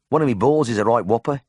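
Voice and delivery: broad Birmingham patient making his confession; Birmingham accent. A narrator's reading. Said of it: "in a ripe Birmingham accent".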